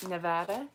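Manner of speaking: "Nevada" with the stressed A said as a short "eh"; accented A in Nevada. Shown as a demonstration. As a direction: hit the middle A of "Nevada" as a flat "eh" sound. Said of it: "say it with the eh".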